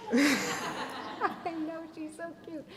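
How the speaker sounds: gushing. Real, not acted. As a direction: squeal it out fondly with a laugh. Gushing over daughter's photo.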